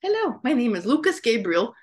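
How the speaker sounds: lightly voiced pet speaking; voicing the cat.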